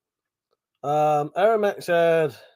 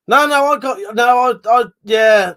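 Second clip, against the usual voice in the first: flustered protest; 6 semitones higher, 6 dB louder than usual. Real, not acted. Protesting a chat misunderstanding.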